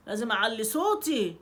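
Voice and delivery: normal talking voice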